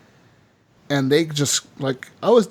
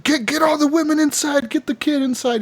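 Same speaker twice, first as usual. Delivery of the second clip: urgent high commands to get everyone inside; Dutch barking orders; 12 semitones higher than usual. Retold or imitated. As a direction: play the gang leader yelling orders under fire, pitched high. Dutch orders during attack.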